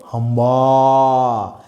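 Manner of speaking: low like a cow, stretching the last vowel; cow's call. Shown as a demonstration. Teacher demonstrates the Bengali cow sound, drawn-out lowing. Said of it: "তার ডাককে কি বলবো?".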